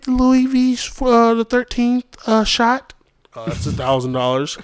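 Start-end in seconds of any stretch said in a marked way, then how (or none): 0.0-2.9 higher, louder, slow ordering voice; mock greedy date. Imitating a date ordering pricey things, higher and louder. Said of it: "He's like".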